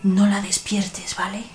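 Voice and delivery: hushed whisper; whispering over a crib. Acted. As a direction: whisper so the sleeping girl stays asleep. Girl asleep, don't wake her.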